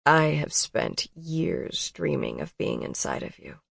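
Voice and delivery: quiet, earnest confession; low emotional voice. A narrator's reading. Colton confessing his longing, low and soft. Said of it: "his voice low and full of emotion".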